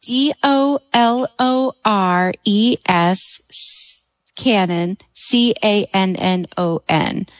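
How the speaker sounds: slow, letter by letter; careful, measured spelling. Shown as a demonstration. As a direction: space out each letter for a listener. Asked to spell the name.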